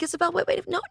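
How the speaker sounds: imitating a frantic call, pitch way up; mock panicked plea. Retelling calling her friend back, mock frantic.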